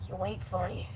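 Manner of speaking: muttered aside. Acted. Same line repeated, said aside.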